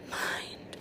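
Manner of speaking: barely voiced whisper of Mind. Shown as a demonstration. Showing viewers the inner word, whispered. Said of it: "say to yourself".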